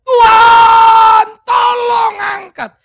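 a high, loud, slow scream for help; desperate shout. Acted. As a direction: yell it out at the end of his strength. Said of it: "dia berteriak". (teriakan putus asa yang keras)

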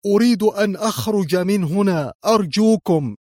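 high, desperate plea; pleading cry. A narrator's reading. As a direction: cry out, begging to be let out. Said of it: "وهتف الخبير الفنلندي".

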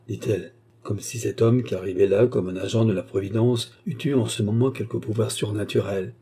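plain reading voice

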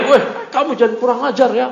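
hammed-up telling-off; mock angry protest. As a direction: play someone taking offence, lightly. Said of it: "Marah dia".

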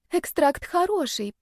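put-on high, slow mumbling girl; timid mumble. A narrator's reading. Girl defends her potion, timid high mumble. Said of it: "промямлила она".

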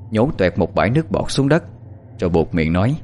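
ordinary delivery, nothing marked

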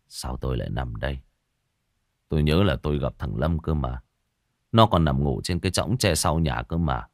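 a groggy man frowning, confused; frowning old man. A narrator's reading. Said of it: "cau mày". (ông già cau mày, giọng trầm và nhỏ)

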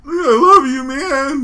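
mock yell. Friend mocks him as the yelling fan.

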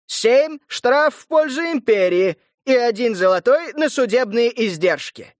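ringing herald's sentence; town crier. Acted. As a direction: announce it clearly to the yard. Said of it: "раздался звонкий голос глашатая".

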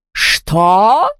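outraged rising question; shrieked question. A narrator's reading. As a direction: let the single word swoop up in outrage. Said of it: "взвизгнула Яспера".

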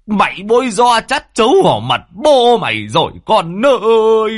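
high, wailing outrage; father's anguished, rising tirade; furious lament. Acted. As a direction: cry out at the disgrace, stretching the words. Enraged father laments family disgrace.

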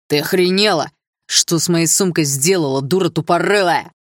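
bully shouting straight in her face; angry classmate yells at the girl who wrecked his bag; furious yell. Acted. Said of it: "Закричал он яростно".

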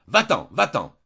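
loud, high cry to send her away; shouting. Acted. Jeanne shouting at the maid to get out. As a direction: yell it at her, full voice. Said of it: "Elle cria".

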